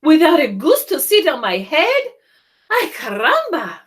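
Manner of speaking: a fussy hen; excited chicken. Acted. Chicken complaining about the goose on her head.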